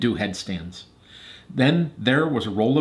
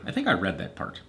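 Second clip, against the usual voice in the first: ordinary delivery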